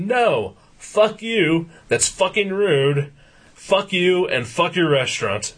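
retold angry telling-off, pitched high; heated high-pitched rebuke, short of a shout; ripping into her. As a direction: re-enact cussing out the restaurant, high and indignant. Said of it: "I just start ripping into her".

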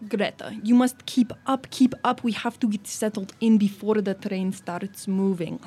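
put-on old Russian princess, lightly accented; commanding Russian noblewoman; a hint of a stern Russian princess. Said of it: "my character's Russian".

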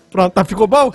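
very deep, low voice; deep sexy voice. Pretend. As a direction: drop the voice way down. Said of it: "é pra continuar com a voz do Marcelinho". (voz grave sensual)

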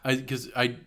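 ordinary talk, nothing marked

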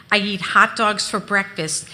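usual speaking voice

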